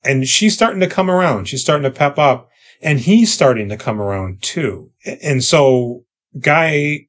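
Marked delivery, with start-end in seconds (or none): none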